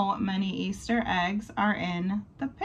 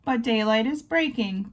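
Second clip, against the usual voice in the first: ordinary delivery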